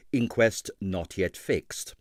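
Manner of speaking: usual speaking voice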